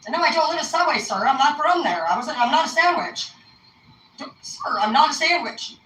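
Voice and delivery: high-pitched mock indignation; exasperated protest. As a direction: protest as if hopelessly misunderstood. Acted exasperated protest to sir.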